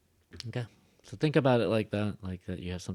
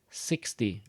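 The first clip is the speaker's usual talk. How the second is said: "sixty" starting on "six" and falling; stress on six. Shown as a demonstration. Teacher contrasting numbers, letting "sixty" fall. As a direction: drop the voice down through "sixty". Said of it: "I kinda go down".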